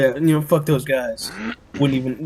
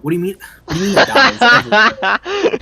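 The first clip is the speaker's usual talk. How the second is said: much higher and louder, laughing in disbelief; incredulous; 10 semitones higher, 10 dB louder than usual. Retold or imitated. Told that the creature dies nightly, a laughing incredulous outburst. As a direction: laugh it out like the claim is absurd. Retold disbelief at friend's answer.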